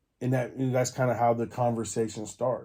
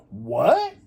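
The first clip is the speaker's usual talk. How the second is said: a sharp, much higher disbelieving what; shocked at the news, higher and louder; stunned what; 10 semitones higher, 4 dB louder than usual. Retold or imitated. Driver told his tags expired, shocked higher what.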